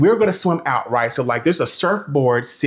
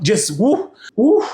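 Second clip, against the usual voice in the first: swooning woo; 6 semitones higher, slower than usual. Real, not acted. Reacting to the handsome guide.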